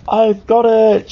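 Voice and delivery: loud, high cry of sudden inspiration; excited shout. A narrator's reading. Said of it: "shouts Ted".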